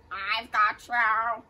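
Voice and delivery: slow, loud gurgling monster voice. Acted. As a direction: gurgle it like a wet blob. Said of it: "it gurgled".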